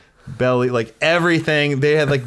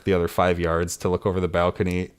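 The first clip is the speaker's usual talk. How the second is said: ordinary talk, nothing marked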